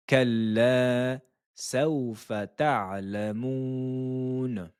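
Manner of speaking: measured tajweed chant of the verse; Quran recitation. Shown as a demonstration. Teacher reciting the verse for students, chanted. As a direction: recite it clearly for students to copy. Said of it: "À vous".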